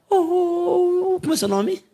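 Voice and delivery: high cooing voice; mock sugary comforter. Preacher mocking a sugary Spirit who asks your name. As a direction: coo it like a fussing aunt. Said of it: "ninguém que o Espírito Santo consola assim".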